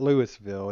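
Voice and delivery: "Louisville" as "LOO-iss-vil"; rejected Louisville pronunciation. Shown as a demonstration. Co-host showing a second mistake, "Louisville" as "Lewis-ville". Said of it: "It's not Louisville".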